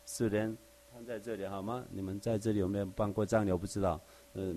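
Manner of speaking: ordinary talk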